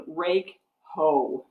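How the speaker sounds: ordinary talk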